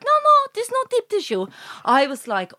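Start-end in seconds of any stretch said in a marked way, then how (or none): 0.0-1.5 high mimicked denial; masseuse. Masseuse denying it to client, high-pitched imitation. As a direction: imitate her brushing it off, pitched way up. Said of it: "And she was like".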